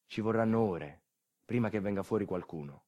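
normal talking voice

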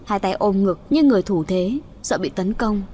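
ordinary delivery

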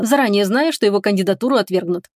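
ordinary delivery

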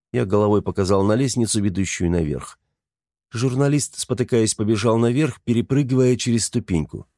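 plain reading voice

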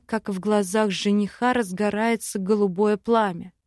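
ordinary delivery, nothing marked

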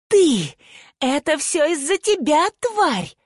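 high, angry snarl thrown in the face; furious snarl. A narrator's reading. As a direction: snarl the accusation, pitched high. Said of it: "Талана прорычала прямо в лицо".

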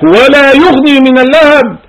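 ترتيل بصوت أعلى; Quran recitation. Acted. Recites verse after "قال لك.

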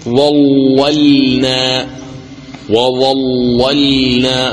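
slow chanted ظللنا, thick first L, light second L; tajweed demo, heavy then light lam in ظللنا. Shown as a demonstration. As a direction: draw out وظللنا, darkening only the first lam. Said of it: "تغلظ اللام الأولى".

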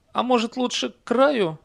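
high-pitched horse character, a suggestion; talking horse. A narrator's reading. Horse voiced in narrated story. (высокий голос лошади)

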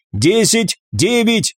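high, separated count; urgent countdown. A narrator's reading. Operator counts down to the start, calling out high and urgent. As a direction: call out the numbers toward launch.

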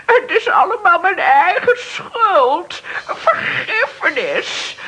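tearful, broken pleading for mercy; crying and begging the ghosts for forgiveness; desperate begging. Acted. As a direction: sob out a plea for mercy. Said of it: "radeloos van ellende om genade smeekt".

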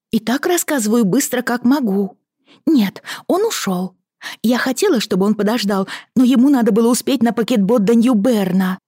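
cartoon princess. A narrator's reading. Lizzie excited telling news.

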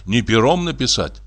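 plain reading voice

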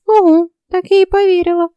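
high, scoffing fairy voice; disbelieving fairy. A narrator's reading. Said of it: "Ульрика покачала головой".

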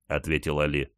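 usual speaking voice

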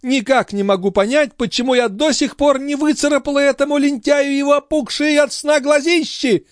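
high-pitched, exasperated tirade; indignant countess. A narrator's reading. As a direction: rant in an outraged lady's voice. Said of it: "продолжала возмущаться графиня".

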